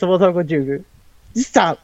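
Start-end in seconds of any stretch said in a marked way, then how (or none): none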